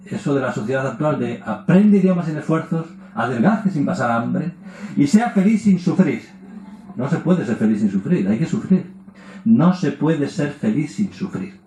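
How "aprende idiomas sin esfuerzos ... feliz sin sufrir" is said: higher, louder, slow advert voice; ad slogan. Retold or imitated. Mocking modern slogans, like an ad, higher and louder. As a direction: sell it like a cheesy advert.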